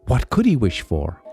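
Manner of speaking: ordinary delivery, nothing marked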